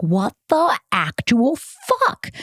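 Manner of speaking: slow, strained, drawn-out wail; mock crying disbelief. Retelling crying over the breakup, drawn-out wail. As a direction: stretch it out like a half-sob. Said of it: "I remember just crying and being like".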